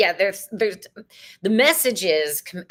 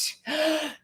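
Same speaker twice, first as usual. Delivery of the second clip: excited gasp; 7 semitones higher, 5 dB quieter than usual. Real, not acted. Excited about solstice.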